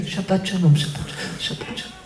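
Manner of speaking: fading to a near-whisper; muttered farewell. Acted. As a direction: mumble goodbye while walking off. Mumbled goodbye walking away. (ברכת פרידה ממולמלת ודועכת)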